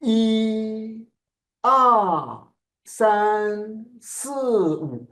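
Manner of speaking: "E, R, san, si, wu", each one long high-launched sound; rainbow syllables. Shown as a demonstration. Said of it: "every tone have this part".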